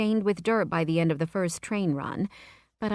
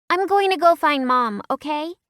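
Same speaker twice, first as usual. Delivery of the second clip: put-on high bright young girl; a girl eager to run off; little girl voice; 9 semitones higher, 7 dB louder than usual. A narrator's reading. Girl excited to leave.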